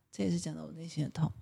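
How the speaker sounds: a pained whisper about her heartache; 叹气后轻声耳语; real sighing whisper. Real, not acted. Asked about snoring, sighs.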